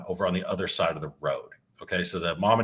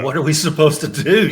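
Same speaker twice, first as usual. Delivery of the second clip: higher and louder, laughing it out; laughing disbelief; 7 semitones higher, 9 dB louder than usual. Real, not acted. The teller laughing at what Parks and Wildlife told them. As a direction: laugh at the absurd rule.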